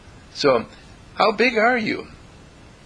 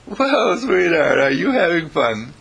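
lightly voiced doting mother, higher; 5 semitones higher, 4 dB louder than usual. A hint of a mother soothing her child. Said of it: "when their mother says".

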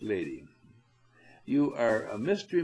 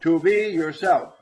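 louder, higher and projected out; 5 semitones higher, 10 dB louder than usual. Shown as a demonstration. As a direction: throw "To be yourself" to the back of the room.

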